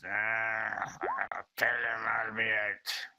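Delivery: put-on old lord. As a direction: answer through the door as an old man. Old lord answers through door.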